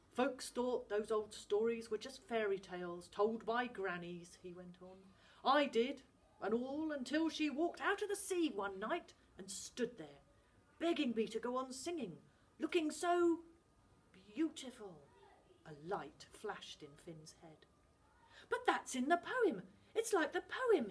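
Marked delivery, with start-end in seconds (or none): 0.1-15.0 louder, wistful remembering; father lost in memory; gruff Scottish dad. Acted. 18.5-20.9 put-on much higher, louder boy; boy cutting in, thrilled; excited interruption. Finn interrupts.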